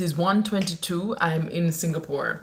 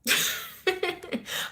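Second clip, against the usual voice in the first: soft airy unvoiced laugh; breathy laugh; 12 semitones higher, 4 dB quieter than usual. Real, not acted. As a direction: chuckle at her own answer. Laughing at her own reply.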